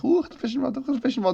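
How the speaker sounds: high, sing-song coaxing call; luring voice. Retold or imitated. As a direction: coax him to look. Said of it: "you're just waving for the".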